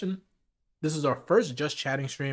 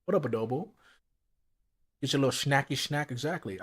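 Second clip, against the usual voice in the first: ordinary talk, nothing marked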